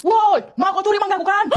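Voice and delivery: high-pitched yell at a thief; caught-you holler; accusing shout. Acted. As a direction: call out sharply, pitch way up. Owner yells at mango thief.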